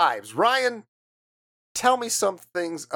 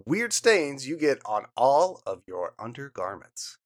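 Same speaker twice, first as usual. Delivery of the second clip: usual speaking voice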